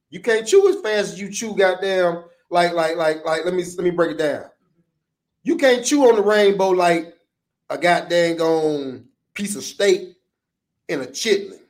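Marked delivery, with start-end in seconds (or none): none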